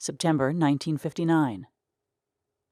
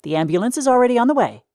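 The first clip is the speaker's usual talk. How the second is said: operator voice; 8 semitones higher, 7 dB louder, faster than usual. Acted. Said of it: "answered the operator".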